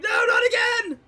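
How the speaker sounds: very high, loud terrified cry; panicked scream. Acted. Shaken dwarf drops to the floor at sight of pistol, panicked scream. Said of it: "he starts screaming".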